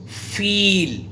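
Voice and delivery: फील with a long stretched ee; teacher demonstrating a vowel; long ee sound. Shown as a demonstration. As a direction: hold the ee in फील. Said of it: "पहली sound क्या है आपकी".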